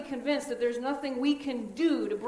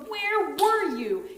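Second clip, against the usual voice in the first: higher, louder, slow grief-stricken cry; anguished demand; 7 semitones higher, 6 dB louder, much slower than usual. Acted. Grieving sisters accusing Jesus.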